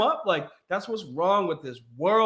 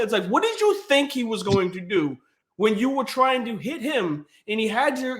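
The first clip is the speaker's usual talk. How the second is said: normal talking voice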